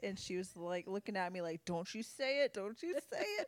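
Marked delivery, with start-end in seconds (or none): 1.7-3.5 warning. Retold or imitated. Friend's warning look voiced.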